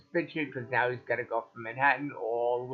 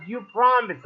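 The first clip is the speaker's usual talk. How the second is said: higher, louder accusation; furious doctor; 9 semitones higher, 6 dB louder than usual. Retold or imitated. Doctor scolding the patient in recovery, angry and loud. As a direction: throw the broken promise back at him. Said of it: "Furious with me".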